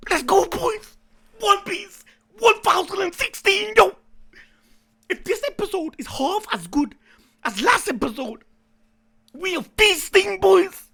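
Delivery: loud pumped-up hype, high; frat bro hype. Real, not acted. Hyped for new episode.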